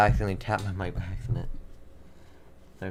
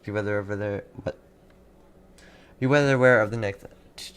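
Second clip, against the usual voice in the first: ordinary talk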